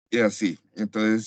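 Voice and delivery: usual speaking voice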